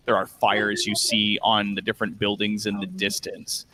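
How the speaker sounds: low, measured narration; unhurried, low-pitched description of a ruined city; game master's scene setting. Acted. Game master shows players the burning city, scene-setting narration.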